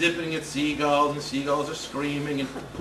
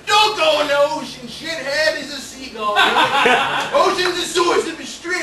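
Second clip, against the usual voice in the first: put-on much louder, higher, drawn-out old man yell; loud cranky old man; 8 semitones higher, 7 dB louder than usual. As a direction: holler it like a grumpy old fisherman. Old guy yelling warning.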